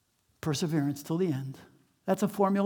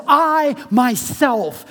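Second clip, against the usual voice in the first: "I myself" slow and louder, both words leaned on; emphatic I; 5 semitones higher, 10 dB louder, much slower than usual. Real, not acted. Pastor showing the emphatic Greek, slow heavy stress. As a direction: press down hard on "I myself". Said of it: "The Greek text is actually emphatic".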